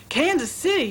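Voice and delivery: high, shocked cry; mother taken aback, crying out; cried out. A narrator's reading. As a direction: cry it out in disbelief. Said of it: "his mother cried".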